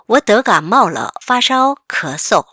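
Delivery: very slow, clear reading; slow read-aloud. Shown as a demonstration. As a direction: model it slowly for the class. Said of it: "같이 읽어볼게요". (아주 천천히 또박또박 읽기)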